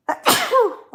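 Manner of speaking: sharp, sudden real cough; loud cough mid-stream. Real, not acted. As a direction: cough it out hard.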